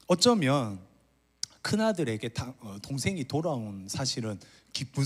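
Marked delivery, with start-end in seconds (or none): none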